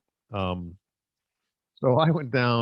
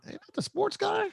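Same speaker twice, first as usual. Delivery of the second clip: much higher put-on voice of a doubtful club crowd; mocking the crowd; 7 dB quieter than usual. Imitating the DC crowd spotting the sportscaster, high mock voice. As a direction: squeak it out like a skeptical heckler. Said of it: "they're all going".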